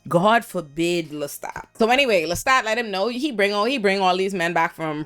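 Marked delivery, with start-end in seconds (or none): 0.1-1.6 sarcastic drawl. Pretend. Sarcastic repeat, drawn out.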